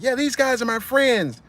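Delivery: put-on high, slow, eager young voice; naive kid. A cousin vouching for his friends, high naive voice. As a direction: reassure with naive pride. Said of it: "his cousin was like".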